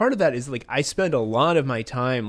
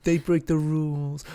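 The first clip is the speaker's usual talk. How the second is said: softer mock quoting of critics; Trump; 3 semitones higher, 4 dB quieter than usual. Politician quoting the specialists' defenders, softened mock impression. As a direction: drop the volume to mimic the pleaders. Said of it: "when he's like".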